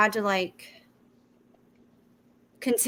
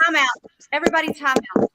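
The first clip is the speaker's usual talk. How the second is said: raised, higher, louder voice; calling time out; 5 semitones higher, 5 dB louder than usual. Real, not acted. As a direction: call a halt over the group's chatter. Host halts overlapping talk.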